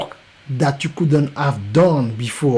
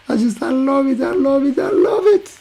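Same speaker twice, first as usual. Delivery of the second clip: delighted gushing; 10 semitones higher than usual. Real, not acted. Host thrilled after his own promo, gushing higher and higher. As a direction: gush with joy, climbing each time.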